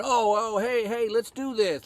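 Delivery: high, excited mimicry; sarcastic put-on enthusiasm; mock eager crowd.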